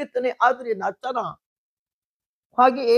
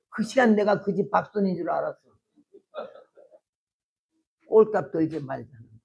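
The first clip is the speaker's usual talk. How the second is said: usual speaking voice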